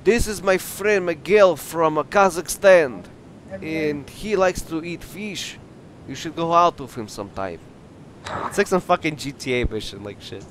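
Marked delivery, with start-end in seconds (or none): 0.0-7.6 slow mock-Russian introduction; drawn-out fake Russian voice; Russian accent. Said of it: "Do you like my Russian voice".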